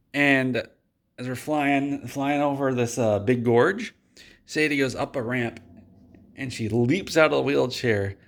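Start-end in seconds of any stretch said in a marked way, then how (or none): none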